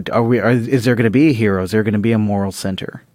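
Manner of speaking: usual speaking voice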